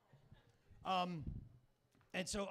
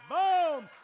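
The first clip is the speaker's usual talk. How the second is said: big loud higher boom; triumphant shout; 10 semitones higher, 16 dB louder than usual. Pretend. Comic shouting after his punchline, triumphant. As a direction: slam it down like a mic drop.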